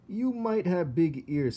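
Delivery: Toulouse mocking. Toulouse mocking Despero, high and teasing. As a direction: tease the little brother. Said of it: "said Toulouse".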